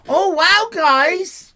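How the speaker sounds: loud, high excited hype. Shown as a demonstration. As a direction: show them how to get all excited. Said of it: "Get all excited".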